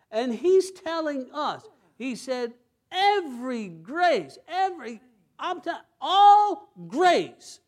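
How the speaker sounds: high, loud, rising preacher's cry; preaching at full force. Acted. Pastor exhorts congregation about grace, loud impassioned preaching. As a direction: drive the promise home to the crowd.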